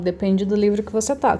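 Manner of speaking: normal talking voice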